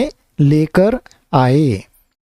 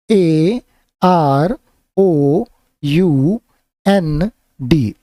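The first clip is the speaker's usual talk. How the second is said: slow spelling; much slower than usual. Shown as a demonstration. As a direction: spell the letters out one by one, slowly. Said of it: "मैं spelling भी बोलते जा रहा हूँ".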